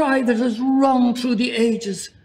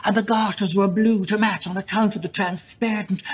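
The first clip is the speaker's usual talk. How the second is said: usual speaking voice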